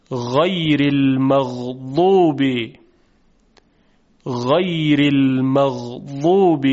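very slow words with smooth voiced غ; soft Arabic ghayn. Shown as a demonstration. Teacher drilling ghayn words for students to repeat. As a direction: stretch each word, gliding the غ softly. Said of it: "شما هم تکرار کنید".

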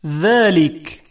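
ذلک with a light, thin ذ; thin dhal. Shown as a demonstration. Teacher demonstrates the fine ذ in ذلک. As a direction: say ذلک with a fine dh, tongue tip to teeth. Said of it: "با ذال".

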